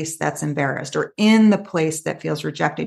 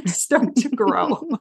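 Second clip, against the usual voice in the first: laughing; 8 semitones higher, much slower than usual. Real, not acted. Laughing at her own Maleficent.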